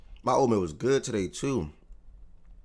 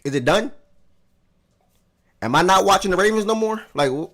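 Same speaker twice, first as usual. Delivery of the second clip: much higher, louder indignant questioning; mock indignation at his friend over football; mock outrage; 8 semitones higher, 5 dB louder than usual. Real, not acted. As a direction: demand an answer in playful outrage.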